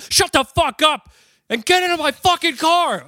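high furious yell; angry shout. Acted. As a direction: scream the order. Guy snaps, acted scene.

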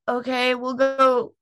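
high, slow, grudging agreement; mock reluctant. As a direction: drag it out like you hate paying up. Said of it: "you could tell that they didn't wanna pay me".

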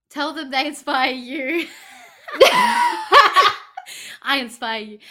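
laughing. Real, not acted. Laughing mid-sentence at own words.

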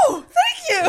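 very high mock-delighted squeal; high, laughing imitation of eager thanks; imitated bright-eyed delight. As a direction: impersonate a bushy-tailed, thrilled guest. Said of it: "bright-eyed and bushy-tailed".

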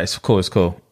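usual speaking voice

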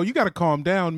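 usual speaking voice

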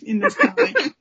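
high giggling finish; laughing. Real, not acted. Host to co-hosts after the kiss page, giggling. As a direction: giggle through the words.

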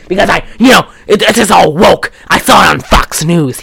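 worked-up mock rant about grievances; lunatic conspiracy theorist. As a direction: imitate an indignant crank in full tirade. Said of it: "if I sounded like a lunatic".

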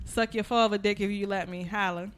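ordinary talk

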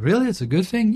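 high, incredulous rising question; teasing the girlfriend with fake surprise; mock disbelief. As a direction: act amazed she means it. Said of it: "I'm like".

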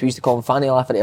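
ordinary delivery

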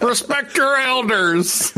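put-on high, slow old man voice; old guy voice. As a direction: play the cranky old-timer. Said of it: "I'm gonna use the old guy voice a lot".

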